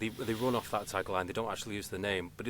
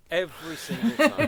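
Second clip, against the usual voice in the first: a laughing punchline, higher and louder; 8 semitones higher, 8 dB louder than usual. Real, not acted. Laughing at own search stats.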